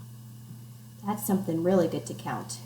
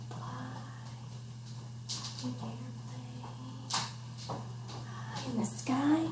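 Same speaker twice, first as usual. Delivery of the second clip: hushed; 4 semitones higher, 14 dB quieter than usual. Pretend. Acting out flying like an airplane.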